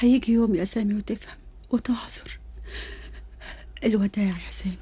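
soft whispered goodbye; whispered farewell. Acted. Tearful farewell.

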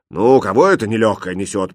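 grumpy cook's put-on grumble; grumbling cook. A narrator's reading. Cook disturbed at his coffee, grumbling. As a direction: grouse like an annoyed old cook. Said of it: "проворчал повар".

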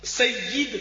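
"سید" as sayyid, short i after the doubled y; correct pronunciation. Shown as a demonstration. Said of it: "یا کے نیچے زیر".

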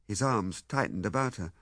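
plain reading voice

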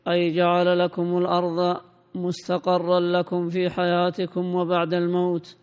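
normal talking voice

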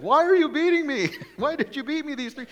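a wounded animal protesting through laughter; talking donkey. Acted.

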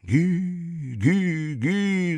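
high echoing syllables fading; fading echo effect; booming echo. A narrator's reading. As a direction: echo it down the halls. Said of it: "загремело эхо в здании".

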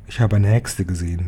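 plain reading voice

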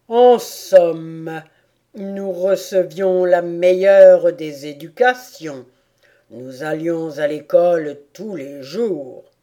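loud, proud turtle voice; tortue fière. A narrator's reading. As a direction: boast weightily as the turtle. (voix de tortue fière et appuyée)

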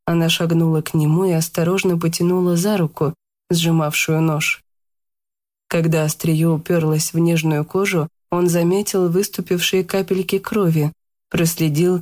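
ordinary delivery

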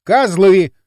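loud, clearly articulated curse; loud and distinct. A narrator's reading. Kirill defying possible hidden microphones, loud and distinct. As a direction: curse loudly and distinctly for any listeners. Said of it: "громко отчётливо произнёс".

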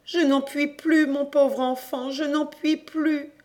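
high, loud, worn-out plea; exhausted cry. A narrator's reading. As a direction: cry out with no breath left. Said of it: "elle cria". (cri épuisé, aigu, à bout de souffle)

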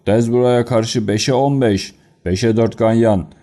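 announcer call. Acted. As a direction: stretch the odds out to the stands. Announcer calling race odds.

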